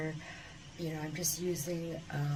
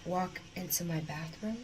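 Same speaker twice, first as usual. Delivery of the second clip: ordinary delivery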